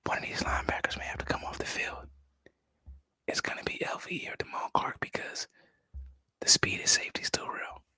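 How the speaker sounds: very soft confidential whisper; secretive whisper. Pretend. Host tipping viewers on who may lose snaps, whispering. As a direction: whisper it like inside information. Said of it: "I'm whispering because I don't want this information to get out".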